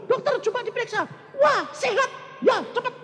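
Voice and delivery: put-on high, quick, eager old woman; high mother's voice. Mother urging the doctor to check her, high. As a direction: push the doctor along, lightly.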